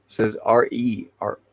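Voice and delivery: ordinary delivery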